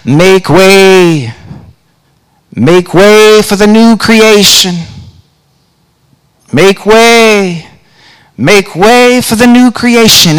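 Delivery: sung in a Rasta voice; rasta speech. Retold or imitated. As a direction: chant it like the old Rasta man. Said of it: "in a Rasta voice".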